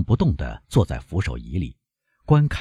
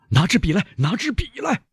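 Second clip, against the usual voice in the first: urgent demand. A narrator's reading. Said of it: "医生要求着".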